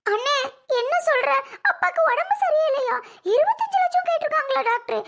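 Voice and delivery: high, loud, panicked disbelief; the blow lands, she cries out in shock; shocked alarm. Acted. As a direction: voice jumps high in frightened disbelief. Sister hears father is hospitalised.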